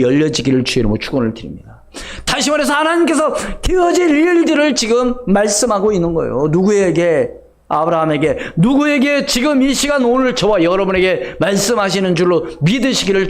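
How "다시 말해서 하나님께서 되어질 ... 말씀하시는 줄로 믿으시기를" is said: high, driving pulpit delivery; full-force preaching. Acted. Preacher telling the congregation God speaks today, full-force preaching. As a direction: press it home to the congregation.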